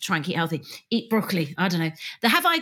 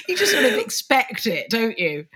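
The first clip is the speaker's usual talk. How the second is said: usual speaking voice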